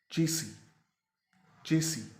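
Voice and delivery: चेसी ending in a long ee, no final s; correct pronunciation. Shown as a demonstration. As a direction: model "CHE-see" as two separate takes. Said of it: "इसका असल तलफ़ुज़ है". (चेसी, आख़िर में लंबी "ई")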